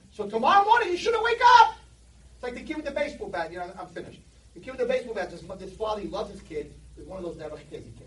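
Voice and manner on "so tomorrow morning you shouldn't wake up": yelled much higher and louder; angry shout. Retold or imitated. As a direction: bellow it like a furious accuser. Angel's rebuke, shouted.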